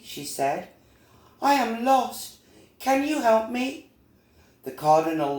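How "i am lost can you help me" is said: high little bee voice; high-pitched, earnest storybook character; Little Bee, pleading. Acted. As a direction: plead like a small lost bee. Lost Little Bee asks help.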